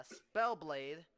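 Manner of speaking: usual speaking voice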